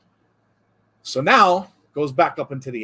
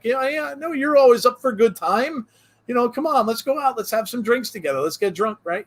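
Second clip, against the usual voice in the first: mock pleading Superman, much higher and louder; 9 semitones higher, 3 dB louder than usual. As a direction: beg Aquaman in a high, hammed-up voice. Superman begging Aquaman, joke.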